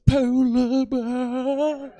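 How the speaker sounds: very slow, drawn-out, guilt-laden polar bear; guilty David Attenborough. Retold or imitated. Comic mocking Attenborough's guilty tone at the crowd. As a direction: stretch it out with heavy guilt. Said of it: "hear the guilt in his voice when he says".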